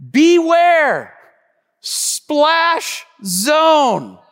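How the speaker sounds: loud sign warning. Retold or imitated. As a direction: boom it out like a posted warning. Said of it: "It said in red".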